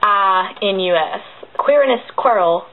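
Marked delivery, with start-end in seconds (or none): 0.0-1.2 slowly, letter by letter; one letter at a time, slowly; spelling out. Shown as a demonstration. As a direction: spell it out at a slow, measured pace. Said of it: "but it's spelled".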